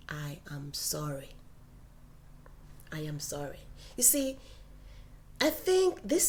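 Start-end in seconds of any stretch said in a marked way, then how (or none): 0.1-3.6 soft apology. Shown as a demonstration. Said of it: "The third sentence is".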